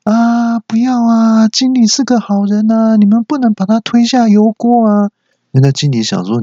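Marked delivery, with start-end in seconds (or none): 0.0-5.1 high wailing mock dream-talk; fake sleep-talking. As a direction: ham up a nightmare to cover. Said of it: "只好假装在说梦话". (假装说梦话的高声哀叫)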